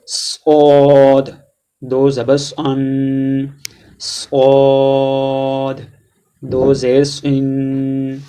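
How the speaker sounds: صاد drawn out very slow, thick and heavy; heavy Saad recitation. Shown as a demonstration. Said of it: "صاد کو موٹا پڑھا جاتا ہے". (صاد کو موٹا اور کھینچ کر)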